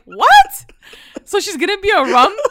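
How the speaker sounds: very high, loud squawk of disbelief; exaggerated incredulous shriek; stunned disbelief. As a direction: react as if utterly floored. Retelling own stunned reaction.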